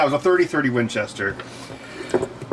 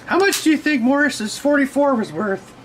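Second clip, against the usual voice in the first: mom's voice; 13 semitones higher than usual. Retold or imitated. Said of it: "and she's like".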